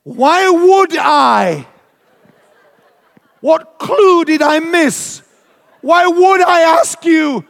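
high, loud, slow exasperated yell; outraged protest at a friend; indignant shout. Retold or imitated. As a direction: shout it back in disbelief. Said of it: "to which I said".

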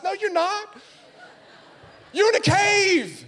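very high, loud preacher cry; fiery preaching. Acted. Preacher rebuking congregation, high loud cry. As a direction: cut the pretence down.

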